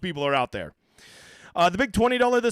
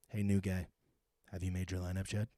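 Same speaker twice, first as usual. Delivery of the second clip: much lower and softer, secretive; hushed conspiratorial voice; 11 semitones lower, 13 dB quieter than usual. Acted. Host slyly teasing a newcomer, low and hushed. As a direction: lean in and whisper-tease.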